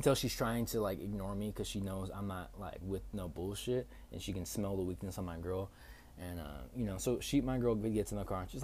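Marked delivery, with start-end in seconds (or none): none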